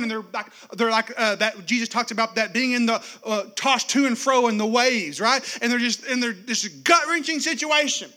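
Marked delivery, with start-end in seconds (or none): none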